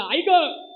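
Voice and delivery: a short yelp of pain as the father is burned; retold cry of pain. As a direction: cry out 'आई ग' as a burn stings. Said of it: "बाप मोठ्याने आरडला". (भाजल्यावर ओरडलेला 'आई ग')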